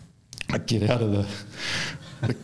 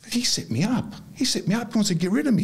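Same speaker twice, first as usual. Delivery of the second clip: retold indignation; 11 semitones higher than usual. Retells his own indignant realisation.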